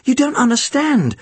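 high, frustrated protest; through gritted teeth. A narrator's reading. Boy pleads with policeman, frustrated through gritted teeth. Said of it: "I was speaking through gritted teeth".

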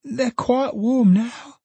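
put-on a little child reassuring, drawn out; slow small child. Child speaking.

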